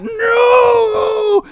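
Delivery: shrieked high and loud no; Spider Queen scream. Acted. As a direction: scream in horror at the burnt eggs. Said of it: "she screams in chat".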